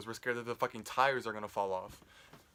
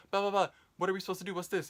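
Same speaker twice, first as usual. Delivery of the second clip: high, fast mock yelling from the family; 8 semitones higher, faster than usual. Family yelling at mom over her truck, high fast mock. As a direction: rattle off the family's squabbling. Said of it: "everyone else was yelling at her".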